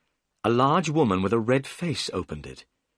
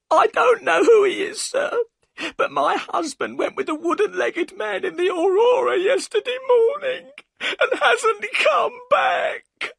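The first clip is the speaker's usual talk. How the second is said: high, loud sobbing, slowing at the end; crying; 5 dB louder than usual. A narrator's reading. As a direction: wail out that he never came back. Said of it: "and burst into tears".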